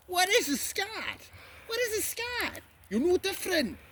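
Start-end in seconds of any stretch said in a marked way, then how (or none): 0.1-1.3 high, slow mock voice of a heckler; mocking impression. 1.7-2.6 mocking impression.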